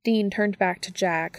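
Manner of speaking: plain reading voice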